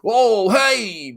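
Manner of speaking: loud, high, drawn-out holler; whooping greeting across a bar; hollering drunk greeting. Retold or imitated. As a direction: shout a big sloppy hello. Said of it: "he was like".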